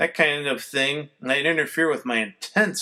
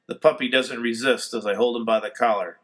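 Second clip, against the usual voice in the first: usual speaking voice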